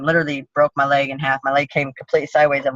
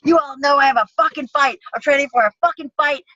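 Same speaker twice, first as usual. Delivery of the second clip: higher, angry retold yelling at the gym guys; chewing them out; 9 semitones higher than usual. Fighter yelling at her training partners after the injury, angrily. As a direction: re-enact telling the training partners off, furious. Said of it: "I'm chewing the guys out".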